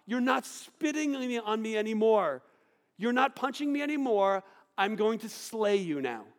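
loud, high, furious shout; imagined Jesus rising up in a loud furious shout; imagined furious outburst. Pretend. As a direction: roar as if finally fighting back. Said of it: "Why didn't Jesus go".

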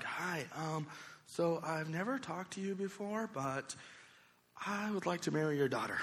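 nervous hushed asking; soft and unsure, asking for the daughter; timid, quiet request. Retold or imitated. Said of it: "I'm like".

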